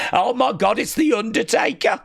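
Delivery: high, excited Brummie fan; Birmingham accent. Pretend. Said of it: "That's the Birmingham accent".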